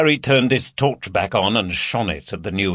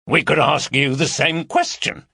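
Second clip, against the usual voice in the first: higher, challenging comeback; retort; 6 semitones higher than usual. A narrator's reading. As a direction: throw the question back at him. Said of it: "retorted Gary, trying to go on to the offensive".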